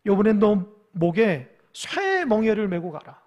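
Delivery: building to loud, thundering commands; angry divine rebuke. Acted. God sending the prophet against the liar, angry rebuke. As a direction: pronounce it as a wrathful sentence. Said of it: "하나님 화가 났어요".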